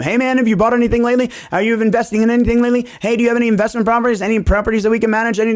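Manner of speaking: high, fast, overly eager sales pitch; eager pestering caller. Retold or imitated. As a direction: rattle off the questions like a pushy cold caller. Mimics his own pestering calls to Nate.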